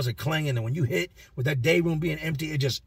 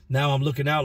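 normal talking voice

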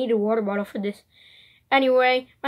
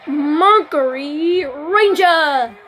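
hero shout; 3 semitones higher, much slower than usual. Pretend. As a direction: yell it like a Power Ranger morphing. Morphing call in hero game.

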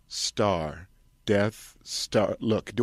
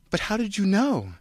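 surprised; 12 semitones higher than usual. Acted. Unmasked filmmaker.

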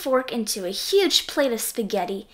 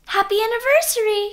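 high, quick croaky frog voice; croaked; 10 semitones higher, 5 dB louder, faster than usual. A narrator's reading. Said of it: "he croaked".

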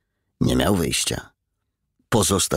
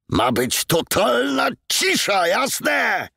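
much higher, slow roar ending in a shout; slower than usual. A narrator's reading. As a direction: roar at the whole room. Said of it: "ryknął Madujew".

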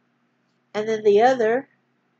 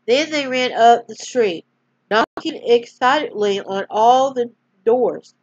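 storyteller reading aloud; story narration; 3 semitones higher, 4 dB louder than usual. A narrator's reading. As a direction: gently read bedtime-story voice. Reads a Bible story aloud.

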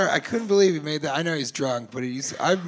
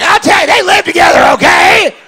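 much higher and louder shouted blurt; hammed-up shouted interruption; mock shouted answer; 13 dB louder than usual. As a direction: yell it over each other like a drunk. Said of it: "were like".

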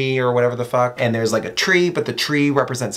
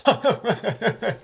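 own laugh; 8 semitones higher, 4 dB quieter than usual. Real, not acted.

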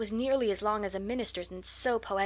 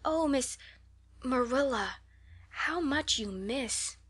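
higher, slow, drawn-out regret; long-breathed pity; 4 semitones higher, slower than usual. A narrator's reading. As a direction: linger pityingly on each phrase. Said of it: "Anne drew a long breath".

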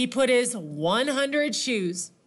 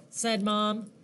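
ordinary delivery, nothing marked

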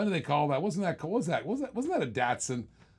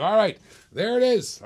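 excited; 6 semitones higher, 8 dB louder than usual. Real, not acted. Big car reveal on stream.

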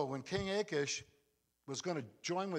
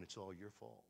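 hushed accusation; 9 semitones lower, 16 dB quieter than usual. Retold or imitated. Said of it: "everybody around you is going".

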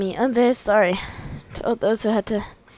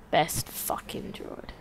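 slow, soft whispered praise; 4 semitones lower, 11 dB quieter, slower than usual. Real, not acted. Player praising the droid, an awed whisper. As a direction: breathe it out in awe.

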